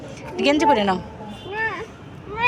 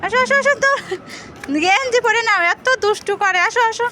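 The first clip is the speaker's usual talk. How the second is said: higher sing-song coaxing; 6 semitones higher than usual. Real, not acted. Mother coaxing child to dress.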